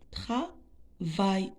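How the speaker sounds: travail as tra, pause, vail; teacher sounding out travail; split into syllables. Shown as a demonstration. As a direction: break travail into two separate syllables. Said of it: "deux syllabes".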